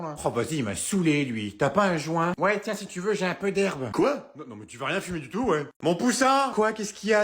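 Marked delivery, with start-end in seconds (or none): 5.8-6.5 higher, louder call across the house; mother calling out. Acted. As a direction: sing out for her son. Mother calls her son.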